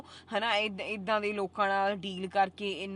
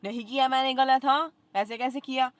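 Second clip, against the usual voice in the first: louder and higher, protesting; loud indignant denial; 5 semitones higher, 9 dB louder than usual. Real, not acted. Defending herself to viewers, indignant and loud. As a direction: throw the denial back at them. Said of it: "ਕਿਉਂਕਿ ਮੈਂ ਨਹੀਂ ਗਲਤ ਕਰਿਆ".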